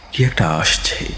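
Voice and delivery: ordinary delivery, nothing marked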